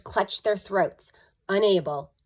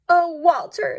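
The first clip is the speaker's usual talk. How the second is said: put-on high, louder child's sigh; sad little Betty; 11 semitones higher, 8 dB louder than usual. A narrator's reading. Betty pleading with Walter at night. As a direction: tenderly scold the dog. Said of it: "said Betty".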